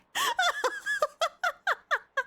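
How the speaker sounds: long shrieking laugh that falls away; helpless squeal of laughter; high squealing laugh. Real, not acted. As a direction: burst out in a high cackle. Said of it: "my cheeks hurt so much from laughing".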